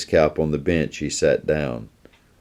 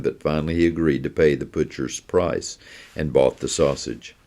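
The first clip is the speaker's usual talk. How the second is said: ordinary delivery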